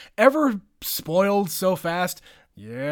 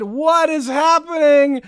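accusatory; 9 semitones higher, 10 dB louder, slower than usual. Retold or imitated. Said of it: "That sounds accusatory".

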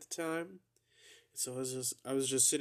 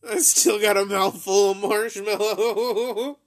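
louder, higher, laughing through the words; 8 semitones higher, 12 dB louder, slower than usual. Real, not acted. Telling about the mouthful of marshmallow while laughing. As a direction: crack up while talking with a full mouth.

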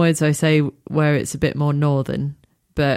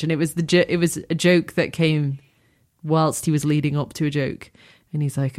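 usual speaking voice

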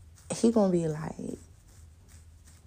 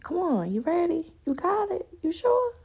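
climbing higher with each question; teasing coaxing; 12 semitones higher than usual. Retold or imitated. Pushing the guy down the slope like her uncle, rising teasing questions. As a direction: egg him on, rising to a squeak.

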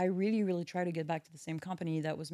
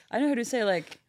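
ordinary talk, nothing marked

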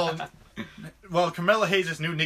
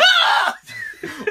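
sudden high laugh; 8 dB louder than usual. Real, not acted. Laughing mid-story.

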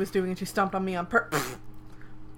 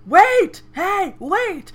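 much higher, louder exasperated yelling; shouting; 9 semitones higher, 10 dB louder, much slower than usual. Retold or imitated. Swordsman yelling at fleeing girl, exasperated. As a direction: shout after them, fed up. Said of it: "he shouts".

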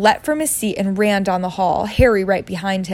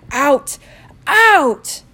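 loud rising yell; shouting; 6 semitones higher, 7 dB louder than usual. A narrator's reading. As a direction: bellow Out twice. Uncle yelling.

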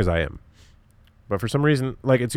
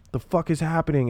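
freaking out; 7 semitones higher than usual. Retold or imitated. Panicked quote of a stressed person.

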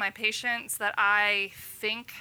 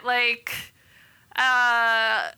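normal talking voice

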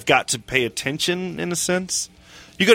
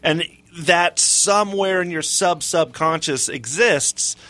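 normal talking voice

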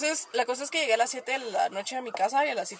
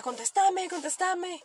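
mock demanding date; 7 semitones higher, much slower than usual. Date's demanding call, retold.

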